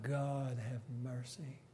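a sinking man's soft plea for mercy; pleading cry. Acted. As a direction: beg quietly from the heart. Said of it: "He cried".

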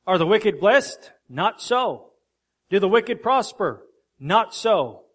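loud, high pulpit call and answer; fiery sermon refrain; preaching at full force. Acted. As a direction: hammer each "Not so" home. Refrain answering own questions.

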